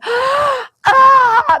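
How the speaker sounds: high, loud exaggerated gasp for air; mock winded gasp. Mocking Cuomo coming up out of breath, hammed-up gasp. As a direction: ham up a breathless post-workout gasp.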